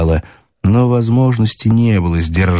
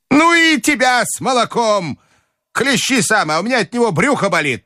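put-on high, testy old-lady outburst; shouting grandmother; 13 semitones higher than usual. A narrator's reading. Grandmother rejecting the milk outing, crying out crossly. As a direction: snap back in a high granny voice. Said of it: "закричала бабушка".

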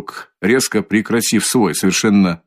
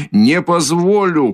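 shouting again; 6 semitones higher, slower than usual. A narrator's reading. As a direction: shout the refusal once more. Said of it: "крикнул было другой раз Раскольников".

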